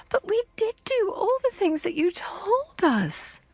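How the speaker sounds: very high, slow and pleading; despairing children. A narrator's reading. Children protesting to the girl, high and despairing. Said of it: "the children cried despairingly".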